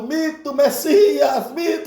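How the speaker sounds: high-pitched mock mob chant; crowd shouting. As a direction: cry out like an adoring crowd. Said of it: "e gritando". (gritaria de multidão fanática)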